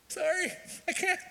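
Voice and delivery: very high, squeaky words breaking into laughter; can't stop laughing. Acted. Pupil in front of the teacher, helplessly cracking up. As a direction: act helpless giggles, losing the sentence to laughing. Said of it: "I can't keep from laughing".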